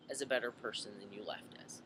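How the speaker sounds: soft whisper. Retold or imitated. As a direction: drop to a whisper. Chief's heartfelt promise, retold.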